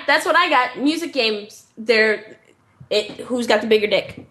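ordinary talk

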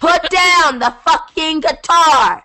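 high, loud and slow, yelling across the call; shouted order. Real, not acted. Host yelling at cohost to put down the guitar, loud and drawn out. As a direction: bark it out to stop the strumming.

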